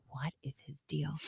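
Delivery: breathy muttered aside; whisper. Retold or imitated. Mom whispering her puzzlement over her son. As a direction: whisper it, baffled, to yourself.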